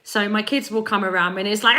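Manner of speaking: usual speaking voice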